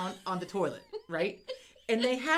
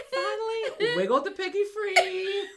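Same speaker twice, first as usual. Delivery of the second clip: laughing relief; 10 semitones higher than usual. Real, not acted.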